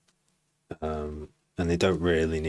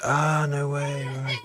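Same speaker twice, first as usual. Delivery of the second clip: high, drawn-out astonished cry; amazed; 9 semitones higher, slower than usual. Real, not acted. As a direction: stretch it out high in disbelief. Reacts to surprising payment news.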